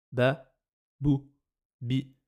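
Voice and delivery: BA, BOU, BI as short, separate syllables; brief vowels in Ba, bou, bi, each on its own. Shown as a demonstration. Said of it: "c'est un son bref".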